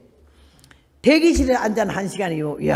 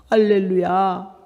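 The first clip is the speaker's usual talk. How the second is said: very slow, drawn-out hallelujah; reverent hallelujah; 2 semitones lower, much slower than usual. Retold or imitated. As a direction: breathe out the praise in awe. Nun's overjoyed praise.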